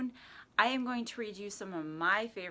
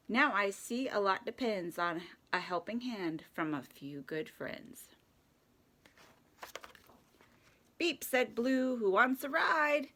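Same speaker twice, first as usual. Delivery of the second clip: lively rhyming picture-book read; children's story narration; 2 semitones higher than usual. A narrator's reading. Reading Little Blue Truck to children at story time, playful narration. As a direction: read it playfully with animal sounds.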